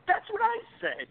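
high-pitched retold outburst; mock-excited, voice leaping high; excited agreement.

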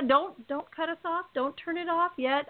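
normal talking voice

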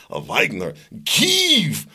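mock newscaster overdoing a Russian accent, jumping high on the last name; fake Russian accent. Host mocks American newscasters' foreign names, fake Russian accent. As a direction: overpronounce it in a phony Russian accent. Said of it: "newscasters talking so".

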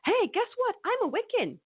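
hammed-up gung-ho young Wiccan; eager convert. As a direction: chirp it like a proud new convert announcing herself. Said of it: "I was like a vegan".

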